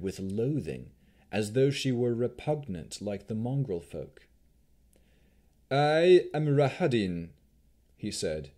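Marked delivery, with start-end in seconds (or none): 5.7-7.3 put-on slow, louder Dusk Elf accent, every word weighed; a proud Dusk Elf accent, announcing his own name. A narrator's reading. Chamberlain greets travelers on the road, Dusk Elf accent. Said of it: "in the distinctive accent of the Dusk Elves".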